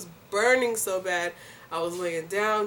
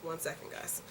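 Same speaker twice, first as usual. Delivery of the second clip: whispered aside; 11 dB quieter than usual. Real, not acted. Mom whispers to viewers while tending her daughter, whispered.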